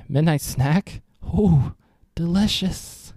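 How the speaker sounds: put-on high, sing-song voice for a small child; reading to a toddler. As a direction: coax a toddler, bright and playful.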